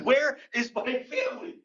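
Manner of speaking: high-pitched panicked question; a lost kid's mock alarm; acted panic. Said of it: "I was panicking".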